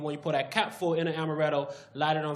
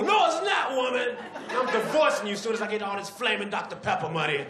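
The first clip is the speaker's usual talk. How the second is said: high loud shout; hammed-up furious inventor; angry husband; 6 semitones higher, 3 dB louder than usual. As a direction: yell at his wife.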